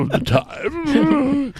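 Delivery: mock choking laugh. Imitating drunk reflux teacher.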